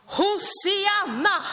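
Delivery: long, high, ringing call of Hosianna; crowd's joyful cry. Acted. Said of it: "De ropar".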